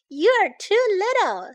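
high, loud, drawn-out wife's voice; dismissive wife. A narrator's reading. Wife turning the mouse away, high dismissive voice. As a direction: pitch it up and stretch the put-down.

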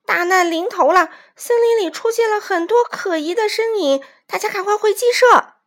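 high, loud, urgent warning; panicked shout. A narrator's reading. Aunt hen sending everyone back inside, panicked shout. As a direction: cry out the danger to everyone. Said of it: "卡里姨妈惊慌地喊道".

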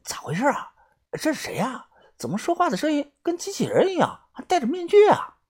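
hushed, high half-whisper; low-voiced asking. A narrator's reading. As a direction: ask under your breath so only your friend hears. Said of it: "豆芽仔小声地问我".

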